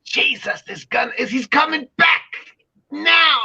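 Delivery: high-pitched crazed proclamation, building to a shout; ranting doom prophet. Acted. Mimicking a crazy rhetorical person for the audience, ranting prophet. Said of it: "rhetorical person saying crazy things like".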